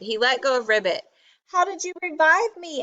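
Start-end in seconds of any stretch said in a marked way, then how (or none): 1.5-2.8 high, quick robot voice; pitched-up, brisk question; robot character. A narrator's reading. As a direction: ask it quickly in a raised character voice. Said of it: "asked Robot".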